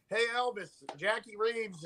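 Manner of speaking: friendly high call to a star. Retold or imitated. Dad calls out to [name].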